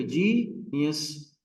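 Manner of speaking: Genius with जी held long; जी... नियस, first syllable drawn out; stress on जी. Shown as a demonstration. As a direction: lean hard on जी in genius. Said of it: "जी पे".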